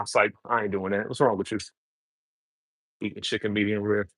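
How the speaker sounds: ordinary delivery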